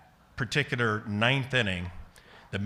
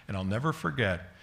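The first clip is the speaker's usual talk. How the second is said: normal talking voice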